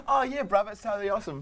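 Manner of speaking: high, laughing mock Kiwi accent. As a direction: put on a playful Kiwi voice. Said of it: "Try one more time, a Kiwi accent".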